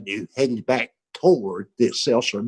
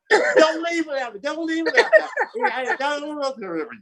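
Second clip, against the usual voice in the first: mock panicked pleading; 13 semitones higher than usual. Friend still pleading as the window goes up, mock panic. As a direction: keep wailing in a put-on high panic. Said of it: "Jay is still going".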